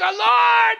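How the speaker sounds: high loud desperate scream. Acted. Pastor acting Peter going under, screaming for help. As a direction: scream for the Lord as you go under. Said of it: "and he screams".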